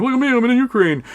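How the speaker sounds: high, quick mocking voice of a show-off soldier; mock bragging soldier. Russian soldier posting from Ukraine, mock boastful high voice. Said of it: "they're like".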